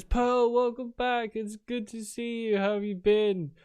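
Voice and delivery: high-pitched, gushing greeting; welcoming mayor, delighted. Acted. Mayor welcomes Pearl back to her hometown, delighted. Game master voices the mayor.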